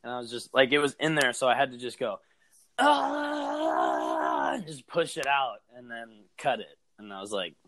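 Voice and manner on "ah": sharp cry of pain as hook pushed; pained scream. Real, not acted. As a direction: yell out in real pain. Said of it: "your scream when you push a hook".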